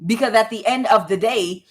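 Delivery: usual speaking voice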